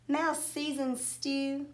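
usual speaking voice

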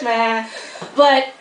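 normal talking voice

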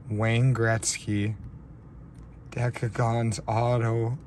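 overwhelmed disbelief, drawn-out words; close to tears. Real, not acted. Card opener seeing the Wayne Gretzky auto, close to tears. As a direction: stretch it out as if about to cry. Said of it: "I sound like I'm gonna cry".

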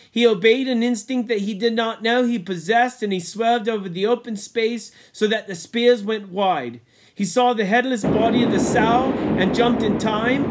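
ordinary delivery, nothing marked

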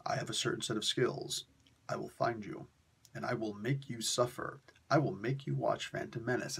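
low, quiet, menacing threat; whisper-close movie-villain threat, lightly done; Liam Neeson impression. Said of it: "you know, it's like".

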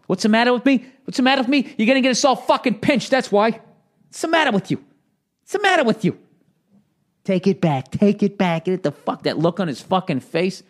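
high, loud re-enacted tirade; furious movie-scene yelling. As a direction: chew them out like an enraged mobster in a film.

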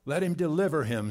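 loud scoffing from onlookers; jeering crowd. Acted. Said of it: "that's what the people spoke".

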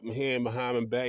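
ordinary talk, nothing marked

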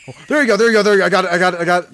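loud, rushed cheering; can't believe you caught it; excited. Real, not acted. Gamer cheering as the rabbit goes down, loudly excited.

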